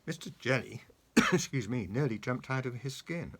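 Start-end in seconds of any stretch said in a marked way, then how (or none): none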